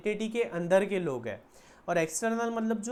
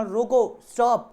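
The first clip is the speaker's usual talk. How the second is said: loud, raised रोको, set apart, then stop; emphatic stand-alone रोको; 3 semitones higher, 9 dB louder, much slower than usual. Shown as a demonstration. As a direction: hit रोको as a complete command. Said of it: "purpose क्या था?". ("रोको" ज़ोर से, अलग आदेश की तरह)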